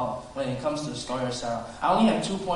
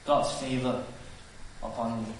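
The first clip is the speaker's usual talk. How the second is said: low, quiet cynicism; cynical; 5 semitones lower, 7 dB quieter than usual. Acted. Sarah inwardly doubting God's favor, cynical. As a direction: mutter it low with quiet disbelief. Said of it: "laughing cynically".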